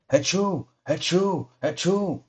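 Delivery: triple high loud sneeze; three sharp, high sneezes in a row. A narrator's reading. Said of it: "And he sneezed again three times".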